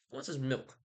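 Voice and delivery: usual speaking voice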